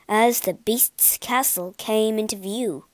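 ordinary delivery

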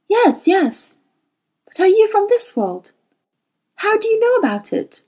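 put-on high, urgent boy's questions; eager boy voice. A narrator's reading. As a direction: press on as an anxious young boy. Boy Will's voice.

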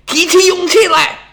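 high, urgent call; rallying cry. A narrator's reading. General orders his fleeing men to fight back, rallying cry. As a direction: summon their courage with a sudden cry.

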